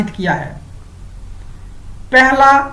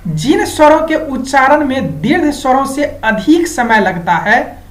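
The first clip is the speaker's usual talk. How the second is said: ordinary talk, nothing marked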